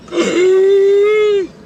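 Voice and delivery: loud, high-pitched gasp of realization; sudden gasp. Retold or imitated. Clueing in to who they are, sudden gasp. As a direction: gasp as if it all clicks.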